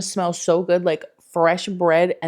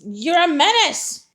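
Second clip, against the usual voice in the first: mock scolding; 9 semitones higher, 6 dB louder, slower than usual. Real, not acted. Scolding dog.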